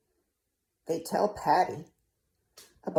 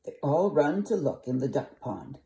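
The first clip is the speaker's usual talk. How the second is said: ordinary delivery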